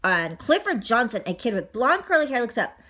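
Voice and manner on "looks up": usual speaking voice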